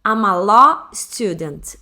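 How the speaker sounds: each word slow and clear for learners; slow teaching demo. Shown as a demonstration. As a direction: model the sentence slowly. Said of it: "eu vou falar assim, ó". (frase modelo dita devagar)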